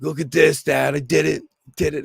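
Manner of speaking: mock-excited eight-year-old shouting to Dad; proud kid boasting. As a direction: play the son showing off his hit. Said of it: "My son's like".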